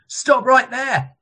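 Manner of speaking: high, slow, ringing challenge; loud commanding shout. A narrator's reading. Roman guard challenges a roaming rabbi, loud commanding shout. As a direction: call out slowly, pitched high to carry. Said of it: "shattered with a loud voice that rang out".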